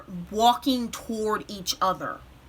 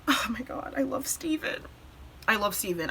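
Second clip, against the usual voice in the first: holding back tears; 8 dB quieter, faster than usual. Real, not acted. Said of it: "try not to cry".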